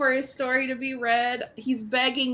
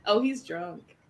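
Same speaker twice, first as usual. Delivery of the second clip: usual speaking voice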